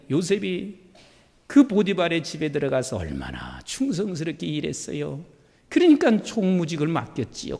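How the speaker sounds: ordinary delivery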